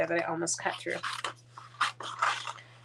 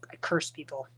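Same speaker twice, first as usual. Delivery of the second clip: unvoiced whispered confession; 5 semitones lower, 6 dB quieter than usual. Real, not acted. Whispering a joke confession to the viewers.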